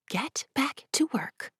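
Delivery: voiceless, slow whisper; a secret silent order over her shoulder; mouthed whisper. A narrator's reading. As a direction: breathe the words without voice. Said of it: "she mouthed".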